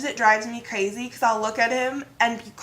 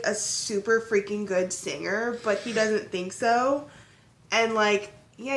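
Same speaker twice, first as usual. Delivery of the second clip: ordinary talk, nothing marked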